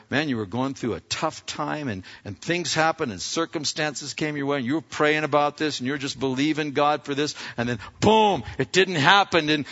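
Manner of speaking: ordinary delivery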